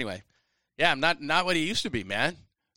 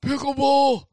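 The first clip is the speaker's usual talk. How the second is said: high, loud hooted word; hammed-up holler of a pickleball fan; mocking pickleball player; 12 semitones higher, 6 dB louder, slower than usual. Mimics calling out pickleball.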